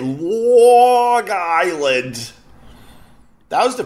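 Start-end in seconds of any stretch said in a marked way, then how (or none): 0.0-2.3 "Long Island" stretched even longer, Long Island accent; exaggerated Long Island accent. Shown as a demonstration. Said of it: "That's how we say it".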